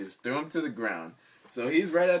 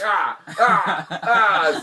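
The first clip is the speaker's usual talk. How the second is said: louder laughing grunts; 6 semitones higher, 10 dB louder, much slower than usual. Retold or imitated. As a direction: laugh off the blows. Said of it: "He's just like".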